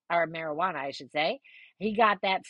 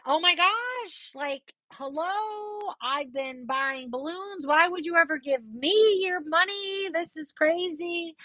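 exasperated protest; 9 semitones higher than usual. Retold or imitated. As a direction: protest as if it's absurd. Her defence, acted out.

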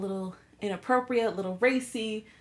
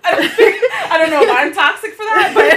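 higher and louder, laughing through words; 10 semitones higher, 16 dB louder than usual. Real, not acted. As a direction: laugh while protesting. Laughing at friend's answer.

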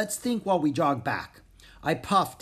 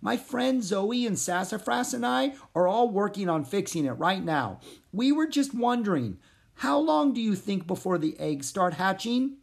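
higher frog voice, polite; 7 semitones higher than usual. Acted. Pip asking the hippogriff how long, in a higher voice. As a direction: ask the question carefully.

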